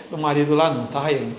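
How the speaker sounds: ordinary talk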